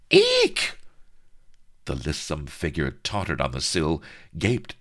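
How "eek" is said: high loud eek of shock; startled squeak. Acted. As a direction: squeal in sudden fright. Heroine startled mid-speech.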